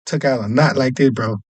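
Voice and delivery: usual speaking voice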